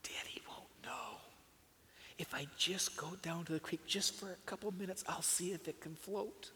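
hushed, secret whisper to himself; whispered thought. A narrator's reading. Boy whispering his secret plan to himself. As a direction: whisper it like a boy plotting mischief. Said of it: "He thought to himself".

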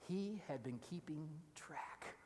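hushed unvoiced aside; preacher whispering a hunch to the congregation; whispered. Real, not acted. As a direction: confide it in a slow whisper. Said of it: "conspiratorial whisper".

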